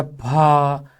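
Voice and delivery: भा drawn out in a single slow flow; one sustained syllable. Shown as a demonstration. Teacher giving another one-syllable example, one sustained flow. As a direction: hold the vowel of भा. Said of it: "तो वो एक है".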